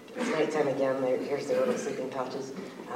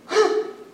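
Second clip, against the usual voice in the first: frightened gasp; 6 dB louder than usual. Acted.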